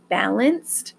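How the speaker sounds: "balanced" underlined with the voice; stress on balanced. Shown as a demonstration. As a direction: lean on "balanced". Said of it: "I stress the word balanced".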